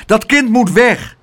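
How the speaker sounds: high, loud indignant demand; angry shout. A narrator's reading. Angry neighbours demanding the boy leave, high cross shout. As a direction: call out crossly as a crowd member. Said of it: "riepen de mensen boos".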